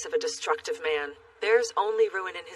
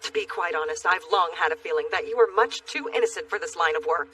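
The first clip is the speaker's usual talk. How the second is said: ordinary delivery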